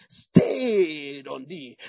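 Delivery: very slow, stretched loud phrase; drawn-out scripture. Real, not acted. Preacher quoting scripture to congregation, very slow and stretched. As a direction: stretch every word.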